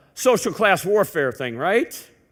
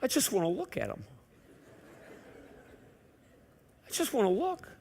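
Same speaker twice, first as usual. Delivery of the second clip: whiny plea; 4 semitones higher, 10 dB quieter than usual. Retold or imitated. Pleading to wife in store.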